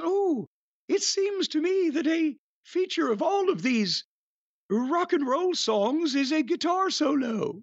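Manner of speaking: high, mock-proper lady critic; high quavering voice, vaguely British lilt; warbling older woman. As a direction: imitate a warbly, lilting old actress. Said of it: "her voice warbles".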